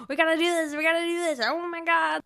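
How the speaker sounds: high, loud mock panic; stressed-out self. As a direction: act out your own frantic spiraling, half joking. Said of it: "whereas I'm like".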